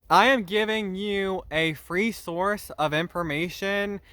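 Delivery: ordinary talk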